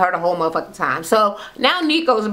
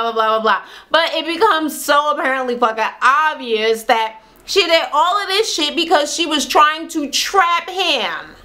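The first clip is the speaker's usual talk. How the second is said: ordinary delivery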